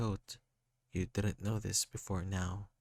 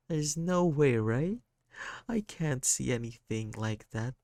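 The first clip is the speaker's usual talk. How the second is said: put-on lightly higher, sorrowful child voice; a hurt kid apologising, voice lifted; sad kid; 5 semitones higher, 5 dB louder than usual. Sad kid character speaking.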